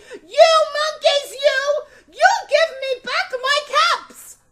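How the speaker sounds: very high, very loud furious yell; furious shout. A narrator's reading. Peddler stamping both feet, shouting at the monkeys in fury. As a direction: scream at the monkeys in full rage. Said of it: "stamped both his feet and shouted".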